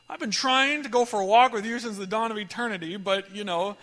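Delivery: imitating wife, loud and comically fed up; exasperated wife. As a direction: ham up the wife's long-suffering reply. Wife answers the neighbor's walk request.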